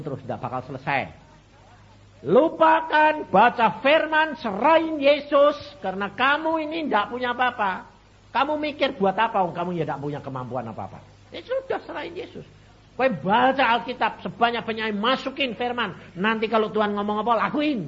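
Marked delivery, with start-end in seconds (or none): 2.2-5.5 loud, high, slow preaching cry; preaching at full force. Acted. Pastor urging the troubled woman, loud slow preaching. 5.8-17.9 loud, high exhortation; fervent preaching. Acted. Pastor exhorting the congregation to obey, loud fervent preaching.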